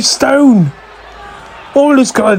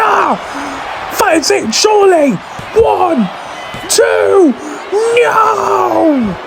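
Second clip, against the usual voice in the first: excited shout; 8 semitones higher, much slower than usual. Real, not acted. Near-fall count at match climax.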